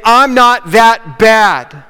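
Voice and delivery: slow, drawn-out smug dismissal; smug self-excuse. Retold or imitated. People looking at others' sins, saying it smugly and slowly. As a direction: stretch it out, pleased with yourself.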